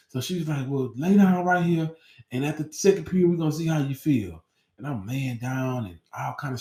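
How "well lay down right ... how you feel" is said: a bit higher, calm and soothing; a hint of a nurse settling a kid; lightly voiced nurse. School nurse telling the student to lie down, slightly higher.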